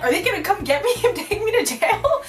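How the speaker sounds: high-pitched comic panic; freaking out. Retold or imitated. Teen asking her aunt about jail, mock panicked. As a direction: play up a frantic, half-laughing question. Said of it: "freaking out".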